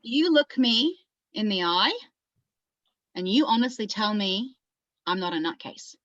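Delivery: lightly played Australian monologue character. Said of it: "it's for an Australian character".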